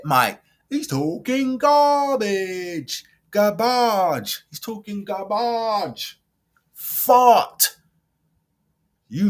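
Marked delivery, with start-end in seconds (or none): 0.7-6.2 higher, slow and drawn out, mocking the pundit; mocking drawn-out garbage. Real, not acted. Vlogger mocking a pundit's take, drawn-out scorn. 6.7-7.8 a high, louder blurt; loud blurted dismissal. Real, not acted. Vlogger dismissing the pundit, loud blurt.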